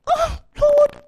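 high, loud, wailing Oh Lord; exasperated groan. Real, not acted. To God, giving in at last, a loud high exasperated wail. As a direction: groan it like a cornered believer. Reluctant reply to God's nudge.

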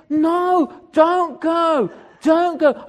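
high, loud retold plea; pleading shout. Man in sauna begging preacher to stay, pleading shout. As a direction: call out urgently to stop someone leaving.

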